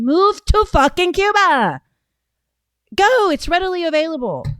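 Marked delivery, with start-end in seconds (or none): 0.0-3.3 loud, high angry outburst; shouting them off to Cuba; angry rant. Real, not acted. Ranting at leftists.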